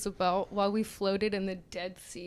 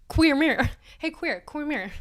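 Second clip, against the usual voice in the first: mock call-out; 5 semitones higher, 3 dB louder than usual. Mocking Jack's call to friends.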